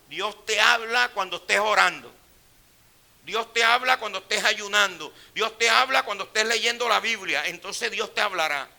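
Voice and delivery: loud, driving preacher's refrain, each line falling; full-force preaching. Acted. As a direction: hammer the promise home to the church.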